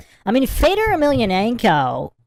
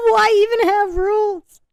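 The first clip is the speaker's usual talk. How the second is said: high, breaking into laughter; wheezing laughter at the clip; laughing; 12 semitones higher than usual. Real, not acted. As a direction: crack up mid-sentence. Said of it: "I find this so funny".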